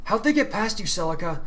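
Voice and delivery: a leader's sharp question to a sentry; demanding. Acted. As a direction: call her out on her post. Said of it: "Kiara demanded".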